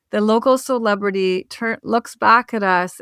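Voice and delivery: normal talking voice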